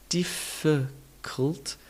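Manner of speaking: difficult drawn out, DIF-fuh-cult; slow syllables, uh in the middle of difficult; schwa demonstration. Shown as a demonstration. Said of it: "add in the all-important schwa".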